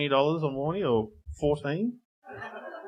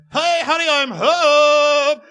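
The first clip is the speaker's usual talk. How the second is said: high, loud sitcom husband greeting; beaming husband calling hey honey; cheery husband; 10 semitones higher, 10 dB louder, much slower than usual. Retold or imitated. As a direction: announce it like a fifties sitcom dad. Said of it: "the guy goes".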